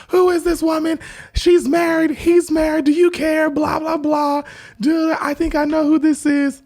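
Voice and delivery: very high, loud retold confrontation; mock outraged shrieking. Retelling a wife confronting another woman, mock outraged shrieking. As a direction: act out her high-pitched accusing yell.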